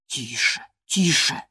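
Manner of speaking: understated hushing reproach; reproachful mutter. A narrator's reading. Gerton hushes Catherine, muttering reproachfully. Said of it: "с упрёком бормотал Гертон".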